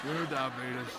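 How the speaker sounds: mock Brimley line. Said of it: "Brimley catchphrase bit".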